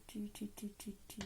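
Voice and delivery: very soft searching mumble; absent-minded muttering. Real, not acted. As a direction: mutter it to yourself while searching. Mumbles while searching for a price.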